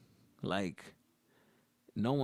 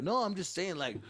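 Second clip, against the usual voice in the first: nervous fake bravado; 6 semitones higher than usual. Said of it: "yelling out stupid shit that I don't mean".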